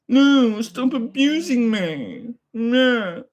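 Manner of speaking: slow, high drawn-out whine; whiny mock victim. Streamer parodying a chatter's complaint, slow high whine. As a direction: stretch it out like a mock crybaby.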